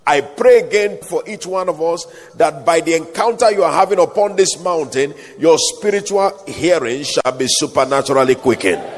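ordinary talk, nothing marked

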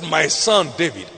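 hushed and low, like a loving father; soft tender voice. Retold or imitated. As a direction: speak it gently, almost a whisper. God speaking tenderly to him.

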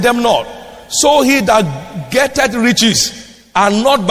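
high, slow, weighty verse reading; ringing, deliberate pulpit reading; declaimed scripture. Real, not acted. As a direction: proclaim the verse in drawn-out phrases. Preaches scripture aloud.

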